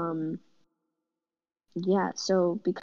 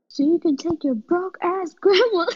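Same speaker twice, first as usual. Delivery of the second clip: high, loud mock yell at mom; retold yell; 11 semitones higher, 8 dB louder than usual. Teen yelling at her mom during an argument, loud mock yell. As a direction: shout it like a kid losing it. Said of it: "I'm pretty sure I was yelling".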